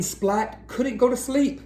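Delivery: plain reading voice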